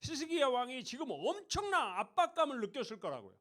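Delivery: ordinary talk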